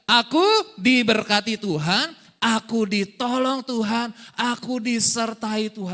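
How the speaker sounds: loud, slow, emphatic proclamation; group declaration. Shown as a demonstration. Preacher leading congregation to say it together, loud slow declaration. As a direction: lead a crowd through it slowly at full voice. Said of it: "Sama-sama katakan dua, tiga".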